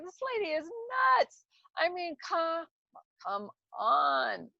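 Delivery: high, loud mocking disbelief; incredulous scoff. Retelling her reaction to the psychic-obsessed friend, high and loud in disbelief. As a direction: scoff it up high, then shout the come on.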